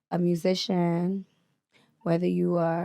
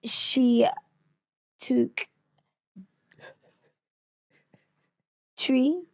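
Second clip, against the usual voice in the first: halting, high-pitched attempt at British accent; 4 semitones higher, much slower than usual. Shown as a demonstration. Trying to copy the host, halting British accent. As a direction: sound out each British word separately. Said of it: "now try do me".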